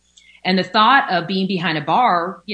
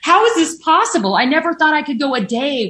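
stunned what, pitched way up; astonished disbelief; 8 semitones higher than usual. Retold or imitated. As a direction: cry it out as if the miracle just landed. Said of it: "it was just like".

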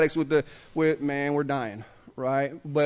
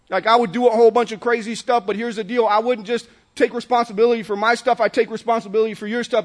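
normal talking voice

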